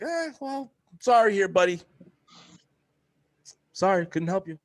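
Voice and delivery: high, put-on voice, falsely sorry; mocking fake sympathy. Mocking coworkers who hoped he'd fail, in a smug fake-sorry voice.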